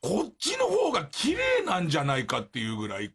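ordinary delivery

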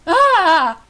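short loud cry of horror; shocked scream. Real, not acted. Player reacts to the shooting, loud shocked scream. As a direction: scream out in dismay.

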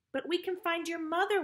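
high, imploring plea; earnest, high-voiced begging. A narrator's reading. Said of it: "Wendy begged".

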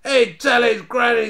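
high, loud derisive hoot; shrieked gang ridicule; cracking up, mocking. A narrator's reading. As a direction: crow it as if helpless with mirth. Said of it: "they cracked".

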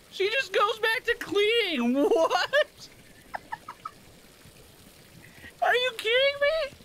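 high, squeaky laughing outrage; incredulous laughing squeal; laughing disbelief. Real, not acted. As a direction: crack up in disbelief.

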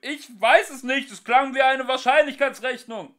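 high, loud shouted explanation; shouting. Acted. Ford shouting an explanation to Arthur, loud. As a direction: keep yelling over the roar. Ford keeps yelling over chaos.

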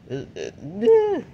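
very high, slow figure voice; squeaky character voice. Acted. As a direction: squeak it out slowly. Toy character voice.

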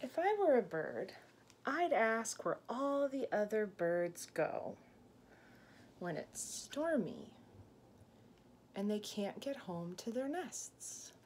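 ordinary talk, nothing marked

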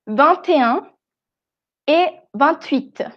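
"vingt et un", "vingt-huit" with T linked to the vowel; sounded T liaison. Shown as a demonstration. Teacher shows the rule before vowels, liaison T in "vingt". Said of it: "cuando es con una vocal".

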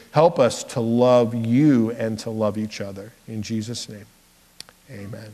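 hushed prayer to God, fading at amen. Acted. Closing prayer.

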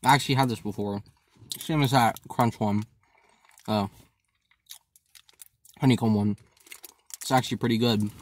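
talking between bites; chewing while talking. Real, not acted. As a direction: mouth full of chocolate. Seen 2: Bitten bar held near mouth, jaw chewing, cheeks full through most words.

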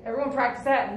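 ordinary delivery

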